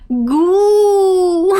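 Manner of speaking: high, loud whimpered goo; mock crying noise. Child left behind, loud whimpered goo. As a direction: wail it like a sad kid. Voicing the bike girl.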